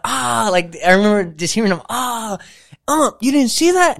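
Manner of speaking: high whiny mock protest; complaining opponents. Retelling opponents protesting the slide, high mock whine. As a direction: whine it like the other team.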